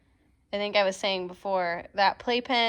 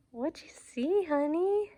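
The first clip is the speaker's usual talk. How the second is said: dog voice; 8 semitones higher, slower than usual. Real, not acted. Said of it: "my dog voice".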